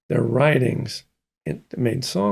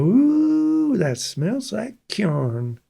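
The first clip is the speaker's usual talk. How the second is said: grandmother's disgust; 8 semitones higher, much slower than usual. Retold or imitated. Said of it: "she'd go".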